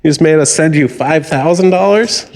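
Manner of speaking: high, panicked scammer act; mock freak-out. Scammer blames the old caller for the transfer, freaking out. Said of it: "then they start freaking out".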